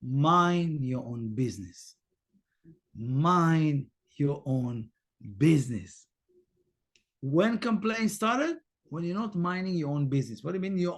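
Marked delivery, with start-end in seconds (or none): 0.0-1.9 slow, lower repeated phrase; measured reminder to yourself; slow mantra. Shown as a demonstration. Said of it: "repeat it for yourself on and on". 2.9-6.1 drawn out, lower, each word stretched; slowed inner repetition; very slow mantra. Shown as a demonstration. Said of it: "repeat it for yourself on and on".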